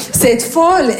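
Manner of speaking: ordinary talk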